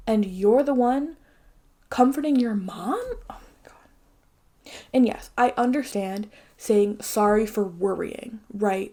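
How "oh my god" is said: whisper. Real, not acted. Exasperated aside.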